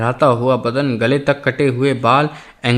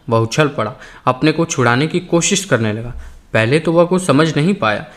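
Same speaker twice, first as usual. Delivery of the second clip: plain reading voice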